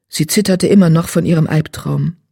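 ordinary delivery

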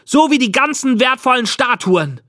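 put-on high-pitched, indignant boy's voice; accusing jab. A narrator's reading. Boy to the old man about stolen statues, accusing hotly.

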